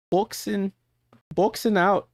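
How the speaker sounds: put-on high, tentative stab at an Australian accent; attempted Australian accent. Streamer trying again at the player's accent, high mock Australian accent. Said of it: "I keep trying to try".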